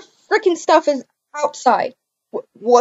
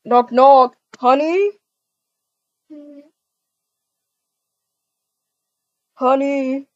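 louder calling through a door; 4 dB louder than usual. Acted. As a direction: call to her inside. Boyfriend calls through door.

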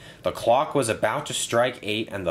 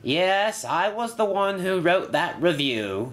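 high-pitched, mildly loud proclamation; loud announcement; 9 semitones higher than usual. A narrator's reading. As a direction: announce it in a raised voice. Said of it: "he said loudly".

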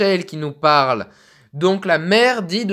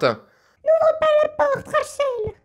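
put-on much higher, thin old woman's voice; little old lady voice; much slower than usual. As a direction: squeak it out like a tiny grandma. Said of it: "on va faire une petite voix de vieille".

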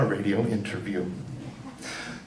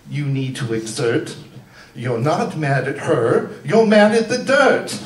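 mock therapist outburst; 3 semitones higher, 5 dB louder than usual. Therapist urging her to let it out, rising to a hammed-up shout. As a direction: build from calm advice into a belt. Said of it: "my therapist said".

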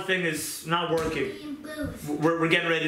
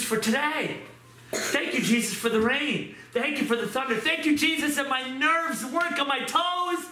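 thanksgiving prayer; 9 semitones higher than usual. Acted.

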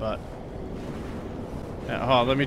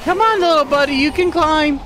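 higher, louder coaxing, like cheering a pet on; high encouraging coax; 9 semitones higher, 3 dB louder than usual. Real, not acted. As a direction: cheer the little buddy up the wall, high and eager. Coaxing character up a climb.

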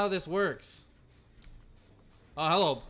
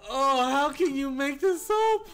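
higher and tearful with laughter; crying laughing; 7 semitones higher, 4 dB louder than usual. Real, not acted. Streamer to chat about the absurd fail, laugh-crying. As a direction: marvel through tears of laughter.

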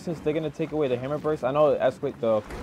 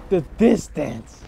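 much louder, higher "Whoa!", then a slow, stretched "The distance!"; amazed shout; 7 semitones higher, 10 dB louder, much slower than usual. Real, not acted. Gamer sniped from across the map, amazed slow shout. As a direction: shout in disbelief and drag the words out. Gets sniped from far away.

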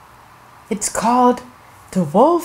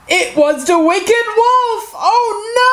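high wailing storybook alarm; 11 semitones higher than usual. Acted. Storyteller revealing the wolf to children, high alarm. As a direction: rise into a dismayed "oh no".

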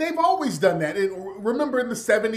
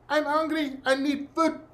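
put-on higher, soft, pitiful child voice; a little boy whimpering that he's hungry; starving child's plea; 7 semitones higher, 5 dB quieter than usual. The child in the ad answering the host, pitifully and softly. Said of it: "What'd you have to say, Damiano?".

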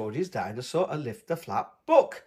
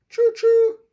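much higher, louder choo-choo; train noise; 9 dB louder than usual. A narrator's reading. Answering Mummy, a high excited train call. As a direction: sing out like a toy train. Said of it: "cries George".

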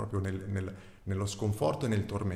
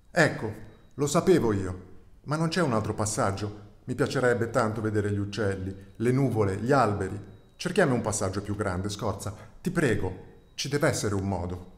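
begging calf; 6 dB louder than usual. Acted. The calf begs Scorza to find a bigger passage, loud pleading. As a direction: plead desperately, almost calling out.

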